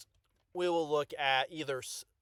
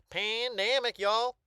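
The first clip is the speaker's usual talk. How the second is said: higher, hammed-up Southern-style accent on "Pandemic"; exaggerated y'all accent; 7 semitones higher, 4 dB louder, slower than usual. Shown as a demonstration. Joking to viewers about his own accent, exaggerated accent. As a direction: play up the accent, wide "PAN-DAM-ic, y'all". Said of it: "I say it with an accent".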